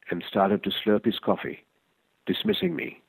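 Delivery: ordinary delivery, nothing marked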